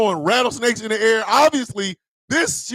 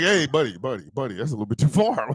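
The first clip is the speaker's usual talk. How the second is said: low, quieter mock warning; a hint of a pleading old abolitionist; lightly voiced John Brown pleading; imitating John Brown; 8 semitones lower, 4 dB quieter than usual. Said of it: "John Brown would have been like".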